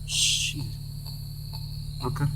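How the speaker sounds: hushed whisper under the breath; whispered curse. Real, not acted. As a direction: breathe the curse almost silently.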